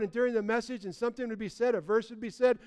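ordinary talk, nothing marked

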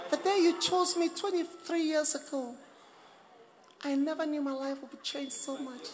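high, soft wifely tribute; mock gushing wife. Acted wife's gushing party tribute.

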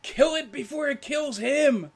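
high shouted battle cry; scream in Elvish. Acted. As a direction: yell it as a war cry. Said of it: "he screams in Elvish".

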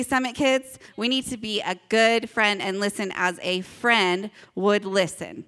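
ordinary talk

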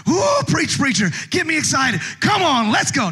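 high sung ooh then loud cheering; mock amen-corner cheer; hyped congregant. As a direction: egg the preacher on. Congregant egging on preacher.

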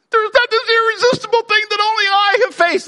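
very high, whiny put-on voice; mock self-pity. Preacher voices the tempted person's excuse, high mock whine. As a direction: wail it in exaggerated falsetto.

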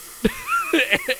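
high burst of laughter; loud laugh. Real, not acted. Laughs at excited story.

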